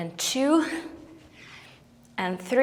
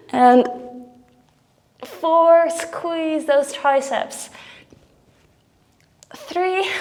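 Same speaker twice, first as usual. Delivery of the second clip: straining with effort; 5 semitones higher, slower than usual. Real, not acted. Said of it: "'cause my arms, whew, are falling off".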